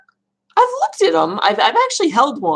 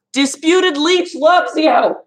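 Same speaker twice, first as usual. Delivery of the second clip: high, slow, drawn-out shout of affection; calling out a message; 10 semitones higher, 4 dB louder, slower than usual. Real, not acted. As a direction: holler it slowly so she hears. Calls a message out to Leliana.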